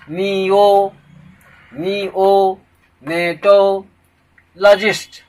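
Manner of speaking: "नियो, नियो, नेटो, लॉजिस्ट" in separate chunks with pauses; syllable-by-syllable demo. Shown as a demonstration. Teacher showing the vowel sounds to learners, chunked pronunciation. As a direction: break it up as nee-o, nee-o, nay-to, la-jist. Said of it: "vowel sound हो। देखो".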